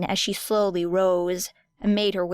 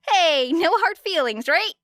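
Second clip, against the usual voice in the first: cheery call; 9 semitones higher, 4 dB louder than usual. Acted. Cheery opponent calling out.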